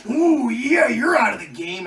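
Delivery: high, loud wrestler impression; Macho Man. Wrestler after the punch, loud gloating impression. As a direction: crow it like a wrestler. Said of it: "uh, Macho Man".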